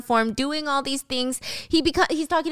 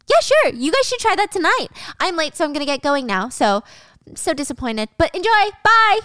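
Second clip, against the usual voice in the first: much higher and louder, overly bright fake cheer; fake cheerful; 7 semitones higher, 3 dB louder than usual. Gia faking cheer as she leaves the couple, overly bright. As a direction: sing out a false goodbye.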